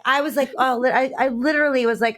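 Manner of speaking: normal talking voice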